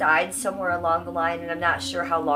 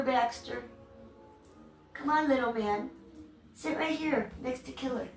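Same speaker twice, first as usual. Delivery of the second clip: high, cajoling pet-talk; coaxing a pet; 6 semitones higher, 4 dB quieter than usual. Real, not acted. Streamer calling Baxter to sit by Killer, coaxing. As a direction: call the little dog over in a high voice.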